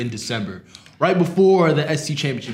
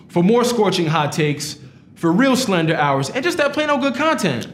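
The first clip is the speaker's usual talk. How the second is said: slow, punchy promo read; announcer outro; 5 semitones higher than usual. Acted. Host signs off the podcast in a slow announcer read. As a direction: sell the show like an ad, slowly.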